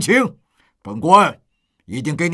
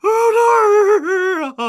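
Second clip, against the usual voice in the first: slow, high sobbing lament; keening wail; 11 semitones higher, slower than usual. Acted. Father lamenting over his dead son, keening wail. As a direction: wail out the grief, stretching every word.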